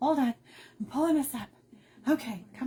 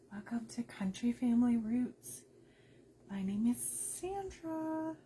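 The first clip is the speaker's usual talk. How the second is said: soft whisper for a migraine; 4 dB quieter than usual. Real, not acted. Host greeting live viewers through a migraine, whispering. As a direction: keep it to a whisper, head hurts. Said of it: "I can't talk too loud today".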